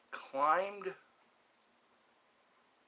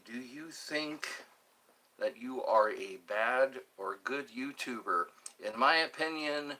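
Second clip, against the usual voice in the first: ordinary talk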